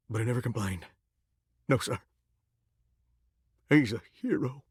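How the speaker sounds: quiet and low with respect; hushed reverence. Acted. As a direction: drop down to a hushed, earnest tribute. Solemn praise of loyal dog.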